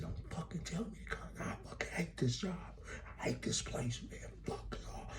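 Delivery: hushed, strained mock rant; imitated meltdown. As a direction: act a driver breaking down, high and barely voiced.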